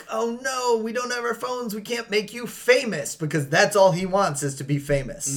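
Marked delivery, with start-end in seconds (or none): 0.1-3.1 put-on whiny girl voice. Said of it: "they're like".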